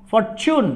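ফরচুন with the tu as চু, "for-choon". Shown as a demonstration. Teacher shows students the word, careful pron. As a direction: model ফরচুন with a চ sound. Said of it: "এর উচ্চারণ হবে ফরচুন".

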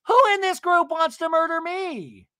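high, loud indignant challenge; hammed-up demand to the whole group; mock outraged yell. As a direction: imitate a furious reality star. Acting out Tamara's outraged challenge.

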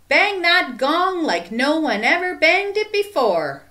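loud, high rallying call. A narrator's reading. Joy urging Stephen to the gong, loud call. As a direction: call it out with gusto. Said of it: "called Joy".

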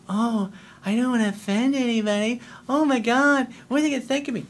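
high, put-on fretful voice; mock worrier. Coach mocking those afraid to offend, fretful. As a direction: imitate an anxious people-pleaser, pitched high.

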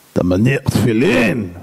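slow, low questioning with a hint of sternness; lightly voiced stern rabbi. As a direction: question him slowly, like a strict rabbi. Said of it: "הסטייפלר היה מאוד תקיף". (רב תקיף, לאט ובקול נמוך)

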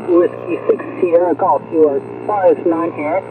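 ordinary talk

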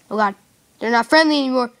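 loud alarmed cry. Real, not acted. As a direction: call out in alarm. Zombies turn hostile.

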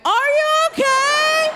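very high, drawn-out yell; mock concerned yell. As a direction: call it out slowly in a hammed-up high pitch. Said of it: "I say the stupidest thing ever".

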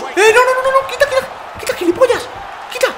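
high, frantic shout; panicked shout. Real, not acted. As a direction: get off me, half laughing. Being pinned in the game.

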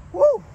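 high, loud whoop of delight; car-show whoop; excited whoop. Real, not acted. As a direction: let out a thrilled woo at the car. Admiring sports car at show.

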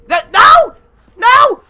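loud, high cries of "no"; frustrated yell. Real, not acted. Gamer being set back a gun, yelling in frustration. Punched while on last weapon.

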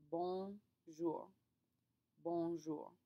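bonjour with nasal bon; French bonjour. Shown as a demonstration. Teacher modeling bonjour for class practice.

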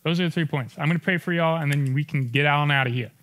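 ordinary talk, nothing marked

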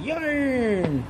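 high, falling drawn-out wail; hammed-up high cry; mock yarn craving. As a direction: wail the word like a desperate cat.

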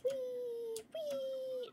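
two high, drawn-out whees; long high whees, lightly done; playful whee. Pretend. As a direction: squeal as if sailing through the air. Flung through the air in game.

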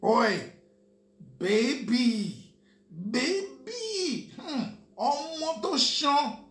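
hammed-up street catcall, stretching baby up high; mock catcaller. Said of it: "Who talks like that?".

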